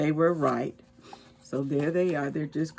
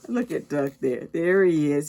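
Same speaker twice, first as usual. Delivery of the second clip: plain reading voice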